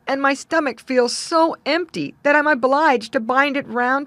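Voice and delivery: loud, complaining wail of hunger; hungry man crying out. A narrator's reading. Hungry man crying to the youth about his hunger. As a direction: groan about starving. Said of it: "he cried".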